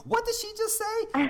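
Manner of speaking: squealed high in mock disbelief; a gasping, high-pitched what-did-she-say; shocked viewer. Interviewer mimicking a stunned viewer, high mock shock.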